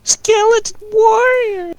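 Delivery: high, slow skeleton shriek; skeleton noises. Acted. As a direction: screech it like a skeleton warrior. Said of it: "Skeleton noises".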